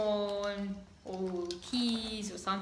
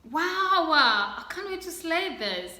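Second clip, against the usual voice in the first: excited; 7 semitones higher, 7 dB louder than usual. Real, not acted. Thrilled at new shoes.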